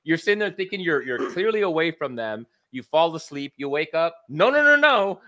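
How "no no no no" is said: mock panic. Acting horror waking among zombies.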